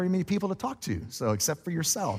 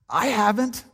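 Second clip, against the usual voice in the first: grudging inner voice; 8 semitones higher, slower than usual. Retold or imitated. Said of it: "And in me, I'm going".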